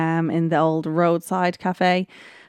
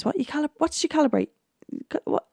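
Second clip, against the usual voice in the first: incredulous questioning; 9 semitones higher, 3 dB quieter, faster than usual. Retold or imitated. Akeem quizzing Harry on calibration.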